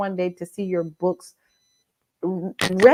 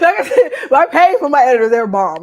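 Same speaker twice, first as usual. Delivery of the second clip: loud, high, laughing boast; real laughing; 6 semitones higher, 11 dB louder than usual. Real, not acted. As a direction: talk through laughter, proudly. Laughing boast about her editors.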